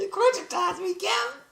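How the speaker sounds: very high, silly squeal; giddy falsetto joke; playful catchphrase. Pretend. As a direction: squeak it out as a goofy slogan. High joke catchphrase amid laughter.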